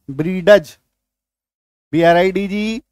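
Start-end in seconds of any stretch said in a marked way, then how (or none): 0.1-0.8 ब्रीडज in two syllables with ड sounded; wrong pronunciation. Shown as a demonstration. Teacher shows reading without silent D, wrong pronunciation. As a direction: read ब्रीडज with the D spoken out. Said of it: "silent नहीं करेंगे तो हम लोग क्या पढ़ देंगे".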